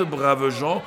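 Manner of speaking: normal talking voice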